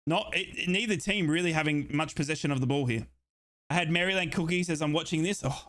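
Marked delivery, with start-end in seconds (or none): none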